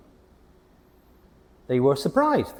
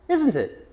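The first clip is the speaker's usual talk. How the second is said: "isn't it" pitched high, then dropping; question tag intonation; 9 semitones higher than usual. Shown as a demonstration. Teacher showing question tags, high-pitched "isn't it". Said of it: "our voice goes up a bit".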